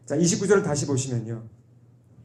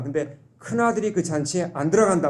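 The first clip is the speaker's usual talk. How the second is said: normal talking voice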